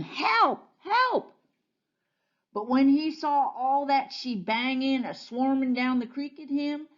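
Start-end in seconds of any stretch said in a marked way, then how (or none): 0.0-1.3 shouting for help. A narrator's reading. Bear clinging to a branch in the flood, shouting for help. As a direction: cry out in a high, falling yelp. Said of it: "clinging to a tree branch and shouting".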